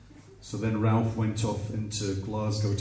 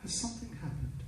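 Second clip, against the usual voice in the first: very soft, slightly high, quick question; talking to a child; 5 semitones higher, 14 dB quieter, much faster than usual. Retold or imitated. Girlfriend asking what happened, hushed as to a child. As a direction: ask delicately as if to an upset child. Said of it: "She talks to me like I'm a child".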